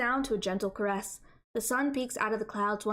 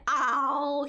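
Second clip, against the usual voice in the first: normal talking voice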